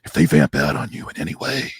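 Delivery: low gravelly tough-guy growl. Retold or imitated. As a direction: grind it out like Rambo. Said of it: "talk, like, with a gravelly voice".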